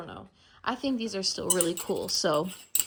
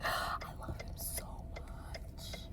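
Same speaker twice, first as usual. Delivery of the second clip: intense whisper; 15 dB quieter, slower than usual. Real, not acted. Gushing over new item.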